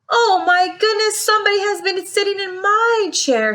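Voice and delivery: high, quick, shocked bear; high-pitched startled bear voice; middle-sized bear. A narrator's reading. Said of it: "said the middle-sized bear in her middle-sized voice".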